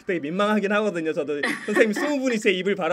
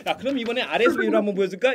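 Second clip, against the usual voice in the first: quick, louder playful taunt; teasing quiz host; 4 semitones higher, 6 dB louder, faster than usual. Shown as a demonstration. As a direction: dangle the next try in front of the kids. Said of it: "약 올리면서". (빠르게 약 올리는 말투)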